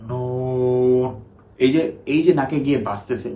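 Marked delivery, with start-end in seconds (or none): 0.1-1.1 নুন drawn out very slowly with the nose held shut, sound blocked; pinched-nose noon. Shown as a demonstration. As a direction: hold your nose and stretch নুন. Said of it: "যখন আমি নাক ধরে দেখাচ্ছি".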